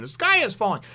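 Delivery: a hint of squeaky panic; light squeaky imitation. Little Komatsu repeats his fear, lightly squeaky. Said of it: "in a high-pitched, squeaky sort of tone".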